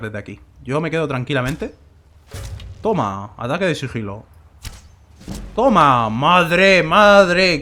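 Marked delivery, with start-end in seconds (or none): 5.5-7.6 loud, higher cry of triumph; excited. Real, not acted. Cheering as his sneak attack lands. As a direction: shout it out, thrilled by the hit.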